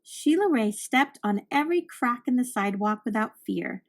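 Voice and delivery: ordinary delivery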